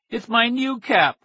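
high, slow tearful plea; a small kid close to crying; tearful little boy. A narrator's reading. Crying boy begs for cap.

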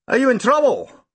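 a concerned constable; london policeman. Acted. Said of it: "he asked".

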